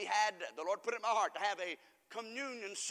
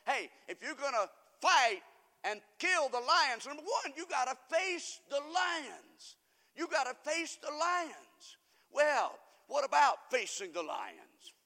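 loud, high, drawn-out preaching; forceful preaching; 5 semitones higher, 6 dB louder, slower than usual. Real, not acted. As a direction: drive each phrase home, slowly and loudly.

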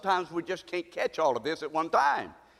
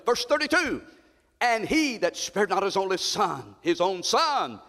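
higher, slow cry on God didn't spare him; high preaching climax; 4 semitones higher, slower than usual. Acted. Preacher stressing the sacrifice, slow and high. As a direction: lift it to a slow ringing cry.

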